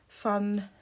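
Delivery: ordinary delivery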